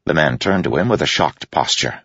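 usual speaking voice

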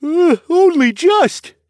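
put-on high boy's voice stretched by a yawn. A narrator's reading. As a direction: answer slowly and loudly through a yawn. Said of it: "the boy replied around a yawn".

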